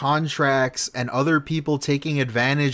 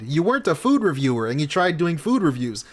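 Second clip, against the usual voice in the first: ordinary talk, nothing marked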